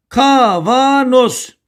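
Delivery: Kavanoz stretched out vowel by vowel; teacher drilling Kavanoz in long, slow syllables; syllable-by-syllable mnemonic. Shown as a demonstration. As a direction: draw out each syllable of Kavanoz slowly. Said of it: "A, A, O kuralı".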